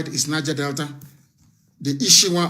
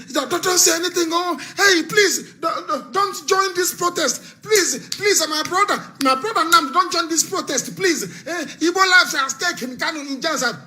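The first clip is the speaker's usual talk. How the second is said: mock pleading; 10 semitones higher than usual. Mocking timid Igbo pleading.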